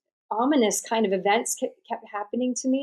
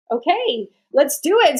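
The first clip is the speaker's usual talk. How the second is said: "let's do it" rising louder and higher; excited resolve; 5 semitones higher, 6 dB louder than usual. Retold or imitated. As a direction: fire yourself up to begin. Deciding to sign up, elated.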